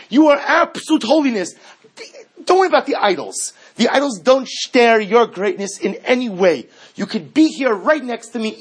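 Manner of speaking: loud, high, indignant cry to God; righteous indignation. Acted. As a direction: demand it like a queen wronged. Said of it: "you almost hear the righteous indignation".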